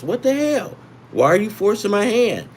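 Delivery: What the hell? thrown up high, fed up; exasperated outburst. Real, not acted. Annoyed by FanDuel's strikeout line.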